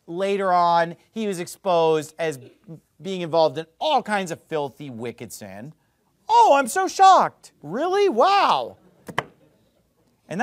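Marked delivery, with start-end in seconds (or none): 6.3-8.8 high, loud sarcastic astonishment; hammed-up shocked act, pitched way up; mock shock. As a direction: feign huge surprise at the obvious, drawn out. Sarcastic reaction to the exposed hypocrisy.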